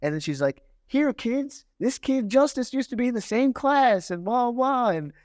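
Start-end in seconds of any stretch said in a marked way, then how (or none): 0.9-4.9 high-pitched mock teacher announcing to a class; imitating chirpy teacher. Teacher putting a former pupil on the spot, mock-chirpy announcement. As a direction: put on a much higher classroom-announcement voice.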